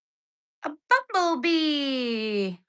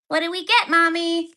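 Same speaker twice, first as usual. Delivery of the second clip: mock child voice; 5 semitones higher than usual. Kid voice asking mommy.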